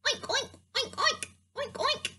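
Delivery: high-pitched little pig oinks; pig oinking. Pretend. Storyteller playing a pig for the kids, oinking high. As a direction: squeal out quick oinks like a piglet. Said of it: "A pig goes oink oink".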